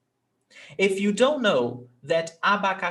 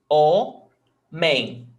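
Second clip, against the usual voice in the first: "o... men" split with a pause, first beat louder; strong "o", then a lighter "men"; syllable by syllable; 5 dB louder than usual. Shown as a demonstration. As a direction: sound out "o, men" as two separate syllables. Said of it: "We've got two syllables".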